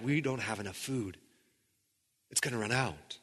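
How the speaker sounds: soft, worried muttering; half-whispered fear of running out; desperate hush. Retold or imitated. Hungry Israelites fret over their food, in a soft worried hush. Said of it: "they're desperate".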